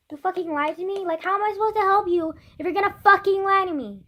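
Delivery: high, loud outraged rant; mad. Real, not acted. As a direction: rant in rising, exasperated disbelief. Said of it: "I'm just so mad".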